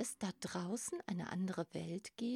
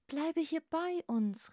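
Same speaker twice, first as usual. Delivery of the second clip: higher, pleading call; 8 semitones higher, 4 dB louder than usual. A narrator's reading. Another crab begs Grasper to stay, calling out. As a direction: call after him to stay. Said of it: "rief ein anderer".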